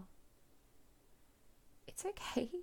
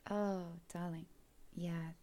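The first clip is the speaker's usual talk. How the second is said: normal talking voice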